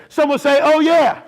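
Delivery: loud, high call for a response; rousing call-out. Real, not acted. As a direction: fire up the congregation. Calls out for congregation's response.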